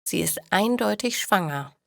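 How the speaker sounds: ordinary delivery, nothing marked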